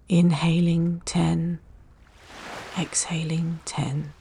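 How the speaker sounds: whispered exhales in a calm count. Acted. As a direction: count the breaths down gently. Breath countdown in meditation.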